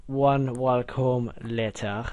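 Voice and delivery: slow, drawn-out time-card narrator; SpongeBob narrator. Shown as a demonstration. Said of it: "I should do that with the SpongeBob thing".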